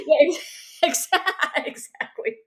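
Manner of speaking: laughing. Real, not acted. Laughing while agreeing.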